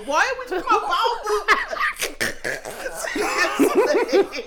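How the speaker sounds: squealing laughter; high laughing. Real, not acted. Still laughing hard.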